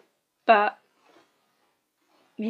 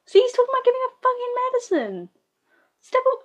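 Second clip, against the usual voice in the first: much higher, fast mock character voice; high, rushed parody of the dim character; mocking squeaky voice; much faster than usual. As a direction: squeak it out fast like a silly cartoon. Mocking the character's reckless behaviour.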